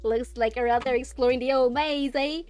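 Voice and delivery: lightly voiced Australian merchant; a hint of an Australian trader selling his wares; Australian accent. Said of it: "do I have to do the Australian accent".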